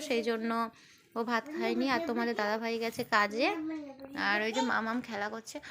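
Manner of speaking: ordinary delivery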